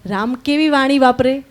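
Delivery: usual speaking voice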